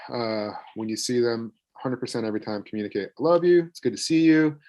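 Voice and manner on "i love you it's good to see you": high and loud, beaming greeting; bright welcome. Shown as a demonstration. Parent welcoming a child home, beaming and loud. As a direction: greet the returning child with open joy.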